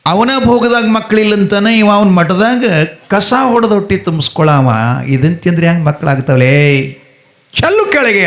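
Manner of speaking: high-pitched indignant outburst; scornful husband. Acted. Doubting husband orders leaves thrown down. (ಏರುದನಿಯ ತಿರಸ್ಕಾರದ ಸಿಟ್ಟು)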